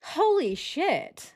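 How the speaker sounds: slow, high-pitched disbelief; shocked. Real, not acted. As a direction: stretch it out high, stunned. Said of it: "I'm shocked".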